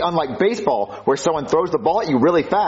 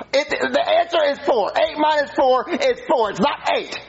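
high-pitched, frustrated correction; worked-up, squeaky protest; exasperated insisting; 8 semitones higher than usual. Acted. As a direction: plead the point, voice pushed high. Acted quarrel, pleading the right answer.

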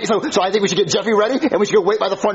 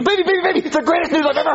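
thrilled; 7 semitones higher, faster than usual. Acted. Brings news he is thrilled about.

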